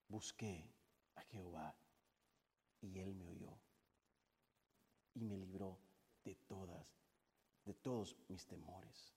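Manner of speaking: very low, soft, broken into short phrases; quiet, reverent scripture voice; hushed testimony. Acted. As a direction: recite it almost under the breath, with long pauses.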